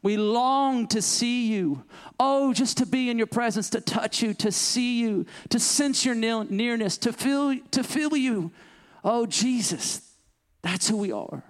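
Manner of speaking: high, slow, aching plea to Jesus; desperate love-sick bride. Acted. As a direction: plead like a bride longing for her groom. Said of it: "The Spirit and the desperate, love-sick bride of Christ says".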